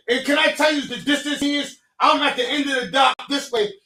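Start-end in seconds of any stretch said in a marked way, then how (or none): none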